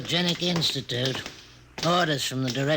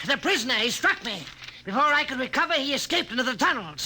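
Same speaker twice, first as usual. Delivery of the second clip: high, fast, rattled report; frightened guard gasping out what happened; breathless panic; 10 semitones higher, faster than usual. Acted. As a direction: blurt it out still shaken. Shaken guard reporting attack.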